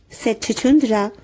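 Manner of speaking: ordinary delivery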